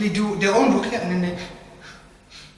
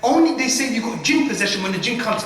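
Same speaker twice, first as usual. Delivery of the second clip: ordinary talk, nothing marked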